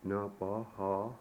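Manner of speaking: "Nabaha" slowly, every a as ah; "Nabaha" drawn out for a learner, ah in each syllable; three ah vowels. Shown as a demonstration. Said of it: "They all have the same sound".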